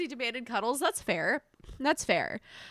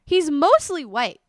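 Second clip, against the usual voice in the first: ordinary delivery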